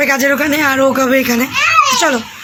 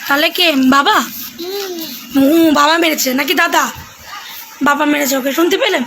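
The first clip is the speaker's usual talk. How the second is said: put-on coaxing a child; 3 semitones higher than usual. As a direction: playfully quiz the child, bright and coaxing. Keeps quizzing child on camera.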